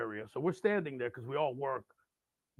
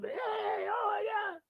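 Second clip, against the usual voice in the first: put-on very high drunken cry; wasted rocker; much slower than usual. Wasted guy hugging a stranger, high gushing squeal. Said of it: "and he's wasted".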